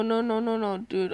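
ordinary talk

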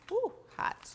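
sudden high yelp at the heat; hot surprise. Real, not acted. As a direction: jump at the burning tray. Grabbing hot ribs from oven.

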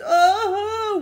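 loud high wail. A narrator's reading. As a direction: wail as if your arm hurts.

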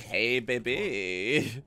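hammed-up low romantic voice. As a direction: croon it like a smooth flirt. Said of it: "catch her in my arms like".

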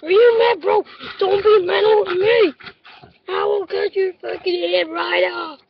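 shouting fake threats at the camera; mock angry rant. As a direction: rage at the viewers like a pretend thug. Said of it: "I'm getting angry now".

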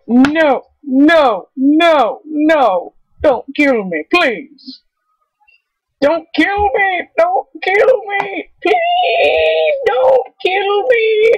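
panicked high pleading, rising to a cry; desperate cry for mercy; begging. Real, not acted. Begging while being hunted.

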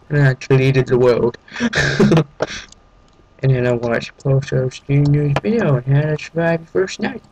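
usual speaking voice

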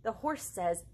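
lively picture-book read with animal sounds; reading to children. A narrator's reading. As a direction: perform each animal for little listeners. Reads Moo Ba La La La aloud.

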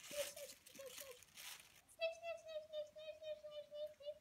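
high, soft sniffing noises; bunny sniffing. Pretend.

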